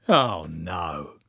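low, drawn-out dismay, not really loud; mild exclamation. A narrator's reading. Joan sees the summoning fail, mild exclamation. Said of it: "exclaimed Joan loudly".

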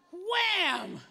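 high, loud smack sound effect; loud wham. Retold or imitated. Preacher acting the boy hitting his father's hand, loud wham. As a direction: shout the slap noise.

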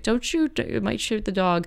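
high, urgent cry to hold fire; alarmed farmer. Retold or imitated. Farmer warns the shooter, in a high alarmed cry.

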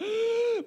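a sharp panicked gasp. Acted. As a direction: gasp in sudden fear. Panicked disciples in storm.

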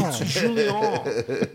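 imitating the brother's French Julien; French accent. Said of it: "speaking English with a heavy French accent".

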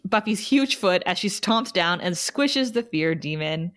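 usual speaking voice

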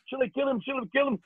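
high menacing question; drill sergeant. Retold or imitated.

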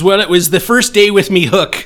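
loud, high pirate bellow; booming pirate voice. Acted. Pirate telling the insurance agent how he lost his leg, booming. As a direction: boom it like an old sea pirate. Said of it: "I love the accent".

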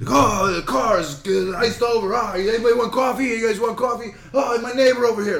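high-pitched, exaggerated mimicry of Vic's accent; hammed-up accent of an online video guy; accent impression of Vic. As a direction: call out high in a put-on accent. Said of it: "uh, accent. He's like".

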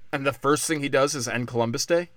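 ordinary talk

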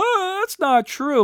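high mocking whiny voice; mocking the co-hosts' denial. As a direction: sneer it like a protesting co-host. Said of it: "be like".

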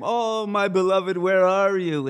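loud, high, hammed-up wail; mock crying lament. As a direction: call out for a lost love in theatrical grief. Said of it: "he wanders around crying".

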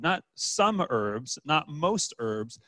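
each phrase drawn out slowly; slow teacher's build-up; slow, deliberate listing. Shown as a demonstration. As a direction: lay out the options slowly, one by one. Building a contrast on herbs.

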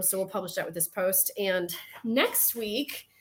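ordinary delivery

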